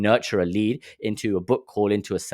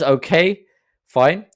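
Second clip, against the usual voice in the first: high, louder grudging okay; mock offended reply; 8 semitones higher, 6 dB louder, much slower than usual. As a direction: act out a sulky salesman giving in. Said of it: "He goes".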